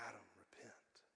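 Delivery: hushed, low whisper; barely audible whispered call to repent; whispering Spirit. Acted. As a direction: whisper it as a gentle inner prompting. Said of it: "I know the Spirit's also saying".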